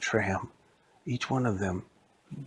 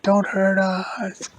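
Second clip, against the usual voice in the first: slow, breathy high whimper; frightened animatronics pleading; scared plea; 9 semitones higher, 7 dB louder, much slower than usual. Acted. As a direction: beg them fearfully. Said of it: "they all gave up".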